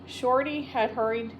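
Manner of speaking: usual speaking voice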